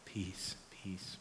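soft "peace" fading into a whisper. Shown as a demonstration. Pastor showing his calming walk home, fading to a whisper. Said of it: "from my little walk in".

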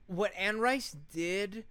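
usual speaking voice

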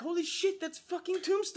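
high, amazed exclamation; retold surprise. Recalling spotting Tombstone, high amazed exclamation. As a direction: gasp it like spotting him on screen. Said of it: "it's like".

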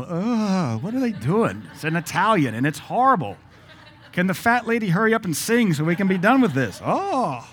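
high, hammed-up groaning complaint; mock exasperation. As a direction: whine like a bored, suffering opera-goer. Imagined listener suffering through an opera.

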